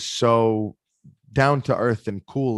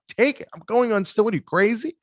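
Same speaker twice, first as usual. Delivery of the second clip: high, disbelieving protest; brushing off his dad as if he's crazy; incredulous; 10 semitones higher than usual. Retold or imitated. To his dad backstage, high incredulous protest. Refusing dad's phone before stage.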